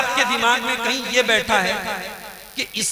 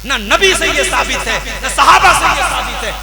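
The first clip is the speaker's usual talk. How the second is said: loud, high, forceful sermon denunciation; preaching at full force; 4 semitones higher, 4 dB louder than usual. Acted. Preacher condemning the women's separate Eid prayer, forceful and loud. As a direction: thunder it from the pulpit.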